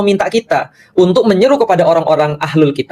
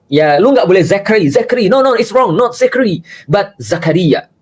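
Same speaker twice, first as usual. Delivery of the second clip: mock pushy insistence; 5 semitones higher than usual. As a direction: play a pushy name corrector, exaggerated. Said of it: "memaksa orang untuk".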